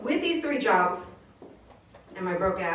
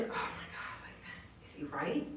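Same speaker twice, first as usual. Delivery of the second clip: whispered doubt; 5 semitones lower, 16 dB quieter, slower than usual. Retold or imitated. Whispered self-doubt in retold thought.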